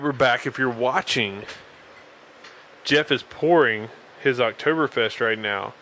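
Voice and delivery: ordinary delivery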